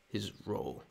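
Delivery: low, soft, hollow line; low villain echo. Pretend. Streamer repeating a Sith line, low and soft. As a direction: let the words sink ominously.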